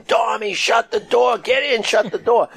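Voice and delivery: high, urgent re-enacted yell; high-pitched shout, reliving the moment; retold yelling. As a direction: act out yelling orders at a friend. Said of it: "So I started yelling".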